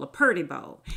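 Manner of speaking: "purdy bowl", Southern-style purdy; drawled purdy. Shown as a demonstration. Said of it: "Not a pretty bowl".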